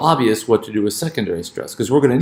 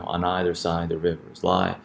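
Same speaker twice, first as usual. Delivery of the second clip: "On either side the rivers lie" in soft, regular iambic beats; every second syllable stressed in "On either side the rivers lie"; alternating stress pattern; 3 semitones lower, 8 dB quieter than usual. Shown as a demonstration. Teacher quoting poetry to show English rhythm, unstressed-stressed alternation in "On either side the rivers lie". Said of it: "the alternating stress pattern".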